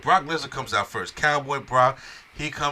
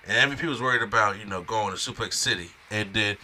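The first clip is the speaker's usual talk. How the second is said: ordinary delivery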